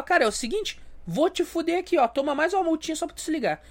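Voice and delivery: mock nasty cop. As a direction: gloat while writing another ticket.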